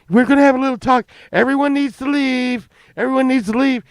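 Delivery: high, loud string of frantic nos; hammed-up panicked mother; panicked mom. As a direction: clear the room in a panic. Mom panicking, clearing room.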